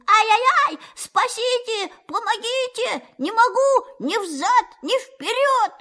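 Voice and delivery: high despairing wail; desperate wail. Acted. Pooh wails desperately, unable to move either way. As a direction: wail for help, utterly stuck. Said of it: "завопил отчаянным голосом".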